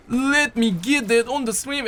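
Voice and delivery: playful mock streamer voice; silly put-on voice. Repeats line in put-on voice.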